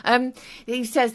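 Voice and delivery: ordinary delivery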